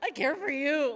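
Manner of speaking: high, loud comic wail; hammed-up frantic pleading; mock freak-out. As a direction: panic theatrically, close to laughing. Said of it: "I would just freak out".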